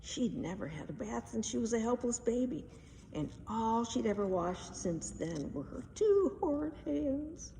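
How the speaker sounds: plain reading voice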